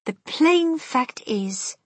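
measured, weighty pace; slow and deliberate. Acted. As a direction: spell it out slowly. Grandmother deliberate.

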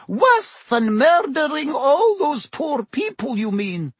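high-pitched eager landlady; alive with curiosity. A narrator's reading. Landlady presses the Minister about murders, alive with curiosity. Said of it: "her voice alive with curiosity".

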